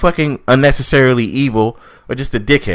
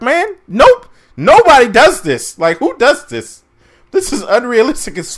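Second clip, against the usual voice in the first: incredulous outburst; 10 semitones higher than usual. Real, not acted. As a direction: shoot the idea down in outrage. Outraged at unrealistic scene.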